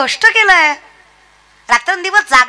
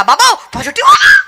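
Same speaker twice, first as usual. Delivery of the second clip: higher, louder yelp with a laugh; mock alarmed shout; 5 semitones higher, 3 dB louder than usual. Hearer cries out in alarm at the positive result, laughing. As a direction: panic at the news. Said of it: "हा इकून म्हणला".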